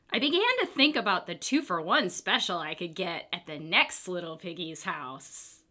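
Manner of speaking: usual speaking voice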